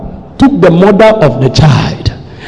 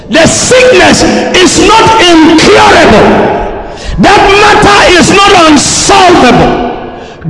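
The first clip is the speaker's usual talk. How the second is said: full-force preaching; 9 semitones higher than usual. Real, not acted. Preacher telling someone listening the matter is not impossible, full-force preaching. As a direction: declare it forcefully, pitched high. Proclaims to the congregation.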